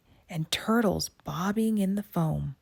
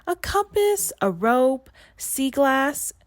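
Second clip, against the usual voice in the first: put-on much higher, louder girlish listing; eager young mermaid; 9 semitones higher, 5 dB louder than usual. A narrator's reading. Melody offering the witch her treasures, eager. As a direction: list them off hopefully.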